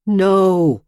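sudden high, loud cry of horror; shouted no. A narrator's reading. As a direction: force the word out in shock. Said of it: "she finally pushes out".

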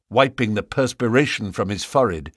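ordinary delivery

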